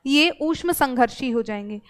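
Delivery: ordinary talk, nothing marked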